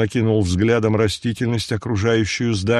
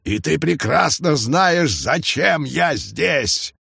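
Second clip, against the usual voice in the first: shouting; 5 semitones higher, slower than usual. A narrator's reading. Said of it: "снова прокричал он".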